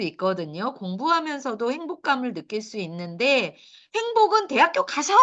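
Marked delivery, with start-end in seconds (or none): 3.9-5.1 lightly mock parent voice, pitched up; a higher, mildly put-on adult's promise; mimicked parents. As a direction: imitate parents and teachers in a higher voice. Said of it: "이렇게 얘기하는 부모들, 선생님들".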